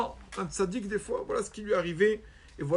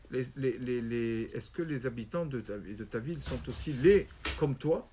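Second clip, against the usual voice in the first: low sneering question; 5 semitones lower, 4 dB quieter than usual. Acted. Rabbi keeps mocking.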